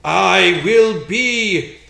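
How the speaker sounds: slow, loud, booming threat; menacing ghost voice. Acted. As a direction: loom over them, drawing out each word. GM voices menacing elf statue.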